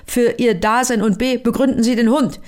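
shouting forester. Acted. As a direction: yell at the suspect like an angry forester. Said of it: "doch der schrie". (Förster schreit den Pilzsammler an)

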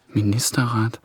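ordinary delivery

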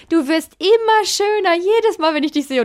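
hoch und langsam, süßlich schwärmend; schwärmerisches Kompliment. Retold or imitated. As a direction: nachgemachte Freundin, verzückt und gedehnt. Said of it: "die hat es aber immer gesagt". (hohe, langsame Schwärmstimme)

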